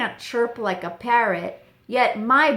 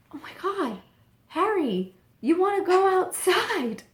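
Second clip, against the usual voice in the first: sudden realization; 9 semitones higher than usual. Retold or imitated. Realizing the dog needs out.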